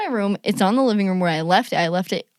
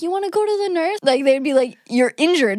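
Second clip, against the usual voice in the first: much higher, concerned voice; fussing teacher; 8 semitones higher, 4 dB louder than usual. Retold or imitated. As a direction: mimic a worried recess teacher. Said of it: "recess teachers would be like".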